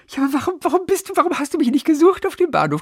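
high, fast, pleading voice; whiny self-quote. Retold or imitated. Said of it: "Und ich nur".

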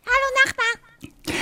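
squeaky, very high and slow; helium voice. Pretend. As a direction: talk like you just inhaled helium. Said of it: "Helium einatmen und ganz laut rufen".

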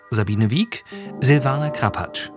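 normal talking voice

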